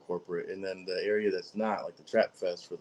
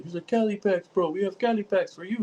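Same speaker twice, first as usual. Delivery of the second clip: higher put-on salesman voice; mock vendor pitch; 12 semitones higher, 4 dB louder than usual. Imitating stall vendors pushing Cali packs, high and put-on. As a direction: parody the pushy seller.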